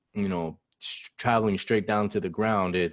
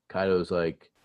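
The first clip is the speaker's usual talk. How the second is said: usual speaking voice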